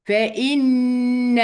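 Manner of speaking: "فإن" with a very long humming doubled n; doubled noon ghunnah. Shown as a demonstration. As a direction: press on the n of "فإن" and hold the nasal hum. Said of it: "النون اللي بغنى برضو، النون المشددة".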